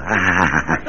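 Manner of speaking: ordinary delivery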